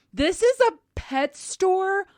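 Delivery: loud, high, slow mock outrage; flat-out declaration. Repeating the clerk's rebuke to the lady, emphatically outraged. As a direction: punch out every word in disbelief. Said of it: "he flat out said".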